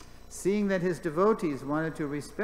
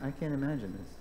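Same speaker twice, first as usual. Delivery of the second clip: ordinary delivery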